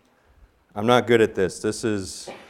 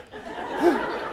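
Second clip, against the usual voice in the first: hushed laugh; 4 dB quieter than usual. Retold or imitated. Laugh at the elk surprise.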